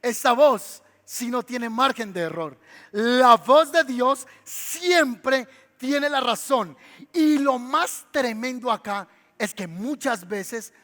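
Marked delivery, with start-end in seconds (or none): 2.9-6.8 building, louder emphatic proclamations; full preaching. Acted. Preacher proclaiming to the congregation, loud and emphatic. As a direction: drive each line home from the pulpit. Repeated refrain.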